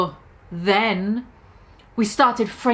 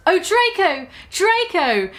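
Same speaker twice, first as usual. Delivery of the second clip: a hint of an overacted moan; mock passionate scream; 6 semitones higher, slower than usual. Narrator voicing Ebony crying out to Draco, hammed up. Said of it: "I screamed while getting an orgasm".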